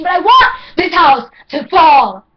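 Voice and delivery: high, loud cry out; anguished shout. Acted. Poet shouting at her ADHD, anguished. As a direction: scream it at the walls. Climactic outburst.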